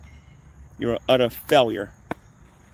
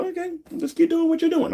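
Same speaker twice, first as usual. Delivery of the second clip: very high, put-on placating voice; mock agreement. Replying to people who warn of prison, mockingly agreeable. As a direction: humour them in a high mock voice.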